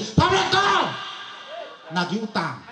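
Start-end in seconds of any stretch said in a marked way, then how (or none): 0.0-0.8 an emotional yelled salam; angry shouted salam. Shown as a demonstration. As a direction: bark it out like a debt collector. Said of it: "kenceng campur emosi".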